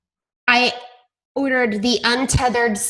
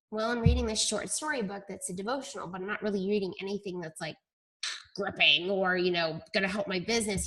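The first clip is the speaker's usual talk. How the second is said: much softer, hurried, embarrassed admission; sheepish mumble; 3 semitones lower, 10 dB quieter, faster than usual. Retold or imitated. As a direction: mumble it like you've been caught out. Sheepish confession to mentor.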